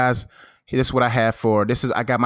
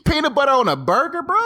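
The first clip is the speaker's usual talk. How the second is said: outraged at the idea; incredulous; 13 semitones higher than usual. Real, not acted. To his co-host about the recipe, high incredulous.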